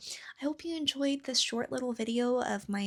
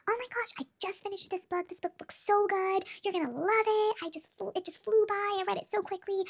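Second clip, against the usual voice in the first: much higher, gushing voice of an excited librarian; 13 semitones higher than usual. Retold or imitated. Librarian recommending a book to a teenage reader, high and gushing. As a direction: play the thrilled librarian raving about a book she just finished. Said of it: "and she was like".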